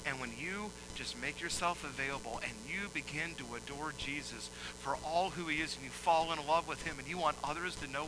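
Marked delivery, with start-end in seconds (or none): none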